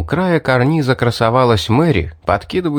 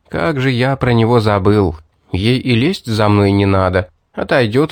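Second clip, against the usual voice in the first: plain reading voice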